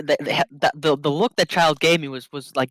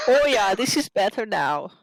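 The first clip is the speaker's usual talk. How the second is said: slow, whiny put-on German accent; 5 semitones higher than usual. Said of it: "do the whining voice to get to the German".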